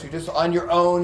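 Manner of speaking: normal talking voice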